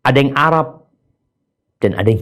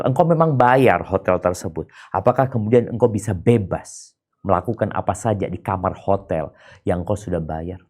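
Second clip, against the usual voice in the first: usual speaking voice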